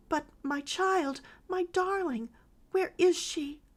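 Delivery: high, slow, tearful pleading; eager sob. A narrator's reading. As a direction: sob it out, desperate for an answer. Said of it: "sobbed the eager mother".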